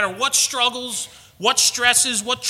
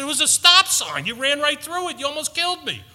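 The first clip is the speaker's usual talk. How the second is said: retold alarm; 6 semitones higher than usual.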